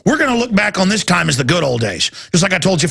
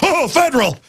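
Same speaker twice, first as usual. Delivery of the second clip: awed mock reverence; 8 semitones higher than usual. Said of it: "Everybody's like".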